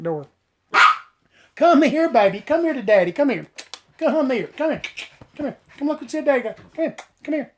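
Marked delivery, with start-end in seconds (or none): 1.6-7.5 higher, louder sing-song coaxing to a dog; coaxing pet voice. Real, not acted. Calling her dog Shasta over, high coaxing baby talk.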